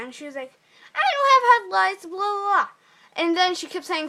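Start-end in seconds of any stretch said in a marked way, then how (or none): 0.9-2.7 high, louder mock protest of a little girl; hammed-up, shrill-pitched imitation of a girl denying it; mocking whiny girl. Girl denies head lice, mocked.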